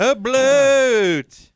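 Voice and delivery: put-on Jersey accent. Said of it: "Is that a blowout with a Jersey accent?".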